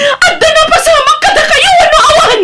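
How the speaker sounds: high, loud angry accusation; bitter shouting wife; accusing yell. Acted. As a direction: rage at him through a half laugh. Angry wife confronting cheating husband.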